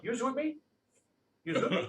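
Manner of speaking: Rhode Island "Use with me", rising question; Rhode Island catchphrase. Shown as a demonstration. Drilling the class on a local phrase, Rhode Island accent. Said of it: "Let's all say that".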